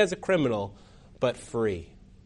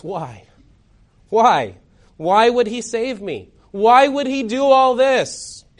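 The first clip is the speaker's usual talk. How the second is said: dazed, shocked; 6 semitones higher, 3 dB louder, slower than usual. Acted. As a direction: start hushed, then cry out in disbelief. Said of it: "Still dazed and in shock".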